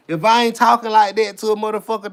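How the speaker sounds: ordinary delivery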